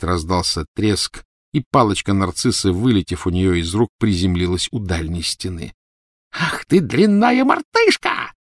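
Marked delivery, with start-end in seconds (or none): 6.3-8.3 high, slow, drawn-out screech; shrieking fury. A narrator's reading. Disarmed villainess insulting the elf, shrieking in fury. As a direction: stretch the insult into a scream. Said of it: "зашлась криком Белатриса".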